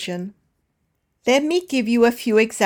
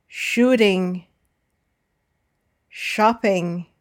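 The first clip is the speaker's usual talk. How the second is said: SHOOT-ing, SHOP-ping, UP-loading, with light -ing; first-syllable stress; slower than usual. Shown as a demonstration. Teacher reads words for learners to repeat, stressing the start as in SPON-soring. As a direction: model "timing", "trading", "zapping" singly, weight on the first syllable. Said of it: "All have the stress on the first syllable".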